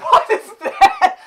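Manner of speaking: high squeal, half laughing in horror; a shocked, squealing laugh-cry; laughing shriek. Real, not acted. Horrified reaction to the video.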